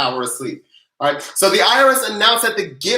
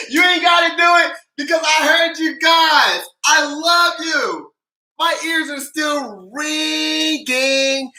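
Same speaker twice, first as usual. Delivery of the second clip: high, shouted thanks, words stretched out; elated hollering; 7 semitones higher, slower than usual. Real, not acted. Host thanking viewers for screaming along, elated holler.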